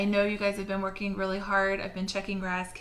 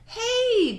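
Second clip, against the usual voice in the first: loud, higher shout after him; yell; 7 dB louder than usual. Acted. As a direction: call out to stop him. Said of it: "Jack yelled".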